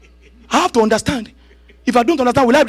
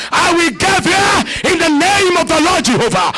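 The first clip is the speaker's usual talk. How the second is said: shouted declaration; 7 semitones higher than usual. Acted. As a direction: proclaim it with full force. Repeated declaration.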